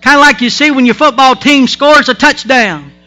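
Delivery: loud, high, rousing pulpit voice; full-force preaching. Acted. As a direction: whip the congregation up with joy.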